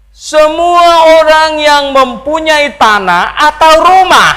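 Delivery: loud, high, drawn-out proclamation; declaimed scripture reading. Acted. Preacher reads Acts to the congregation, loud declaimed scripture. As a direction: proclaim the verse slowly at full force.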